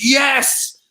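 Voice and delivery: high, elated exclamation; re-enacted burst of joy; excited yes. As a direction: jump at the offer, thrilled. Retold eager acceptance of the offer.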